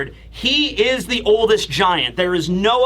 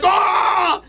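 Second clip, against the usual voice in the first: high, drawn-out "ah" of pain; hammed-up cry of a giant in agony; mock pained yell; 10 semitones higher than usual. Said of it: "causes Jarl intense pain".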